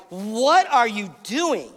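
slow, emphatic mock scolding; indignant telling-off, leaning on each word; angry. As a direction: act out an incensed reprimand. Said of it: "They are angry".

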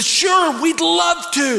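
put-on high eager little-boy reply; eager yes. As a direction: jump at the invite like a ten-year-old. Eager kid answering the boys.

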